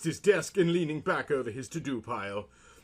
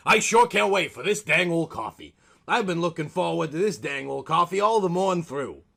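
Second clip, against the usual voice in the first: put-on louder, folksy old-fashioned gentleman; old-timey man; 6 semitones higher, 7 dB louder than usual. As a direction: ham up a quaint man from another era. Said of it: "He spoke like he was from another time".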